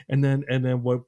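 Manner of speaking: ordinary talk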